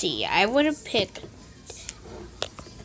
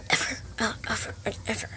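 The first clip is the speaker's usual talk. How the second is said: whispered trail-off; 2 semitones lower, 13 dB quieter, much faster than usual. Acted. Mom trails off after yelling.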